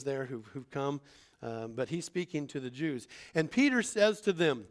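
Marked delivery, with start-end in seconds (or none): none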